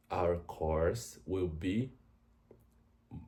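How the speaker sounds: ordinary talk, nothing marked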